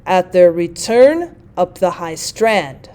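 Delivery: slow scanned reading, landing on "turn" of "return" and on "strand"; pyrrhic example stress. Shown as a demonstration. Teacher demonstrating the last metrical foot, slow scanned stress. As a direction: read slowly, building to a stress on "return", pause, then on "strand". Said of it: "three unstressed followed by a stressed".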